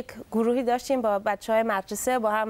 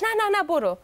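insistent cousin; 9 semitones higher than usual. Retold or imitated. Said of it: "گفتش".

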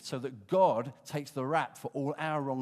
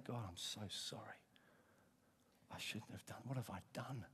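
repentant; 4 semitones lower, 13 dB quieter than usual. Pretend. Imagined repentant prayer in sermon.

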